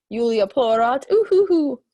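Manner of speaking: hammed-up boo-hoo sobbing, loud; mock crying. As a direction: fake a big childish sob. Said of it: "You can hear the crying now".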